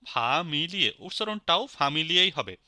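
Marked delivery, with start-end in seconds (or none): 0.1-0.9 "ফা মি লিয়ে" in three stretched beats; broken into syllables. Shown as a demonstration. Teacher repeating the breakdown, separated syllables in "ফা মি লিয়ে". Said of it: "এটাকে যদি ভাঙি".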